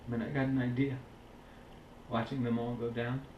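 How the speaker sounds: low, soft, grieving war memory; hushed and low, weighed down; somber recollection. Acted. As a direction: quietly recount the loss of comrades, with long pauses. Monologue recalling comrades killed at war.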